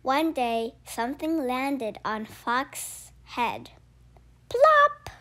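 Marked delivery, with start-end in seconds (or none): 4.5-5.1 high popping plop; plop sound. Acted. Narrator makes a landing plop. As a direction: pop it out like a drop landing. Sound effect in picture book.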